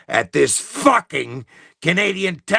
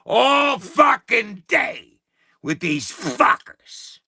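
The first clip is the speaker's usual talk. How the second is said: fed-up shout; 6 semitones higher, slower than usual. Real, not acted. Stuck on hold with the tax office all day, fed-up shout. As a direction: stretch each word in exasperation. Venting about hours on hold.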